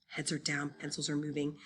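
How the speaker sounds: ordinary delivery, nothing marked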